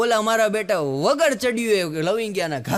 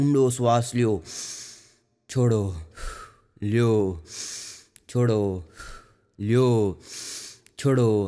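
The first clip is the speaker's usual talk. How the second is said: slow, spaced breathing commands, lower; mock yoga instructor; 9 semitones lower than usual. Imitating the baba leading the crowd in bhastrika breathing, spaced commands. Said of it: "પેલા આપણને બધાને ભસ્ત્રિકા કરાવી".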